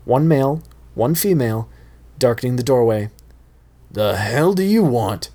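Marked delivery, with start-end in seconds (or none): 3.9-5.3 mild growl. Acted. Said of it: "Johnny growled".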